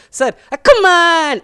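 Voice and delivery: high, slow, drawn-out cheer; kids mocking teacher. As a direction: mimic the teacher's catchphrase to tease him. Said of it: "they just look at him and go".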